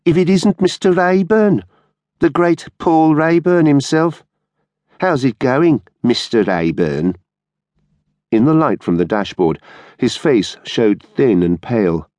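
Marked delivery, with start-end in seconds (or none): 0.0-7.2 high-pitched mocking sneer. A narrator's reading. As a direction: taunt him with mock admiration. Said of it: "he said in a sneering voice".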